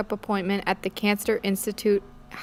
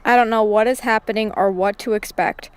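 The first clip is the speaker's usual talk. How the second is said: plain reading voice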